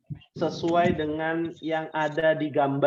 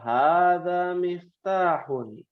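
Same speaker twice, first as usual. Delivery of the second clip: slow model answer; much slower than usual. Shown as a demonstration. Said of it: "teacher models the answer".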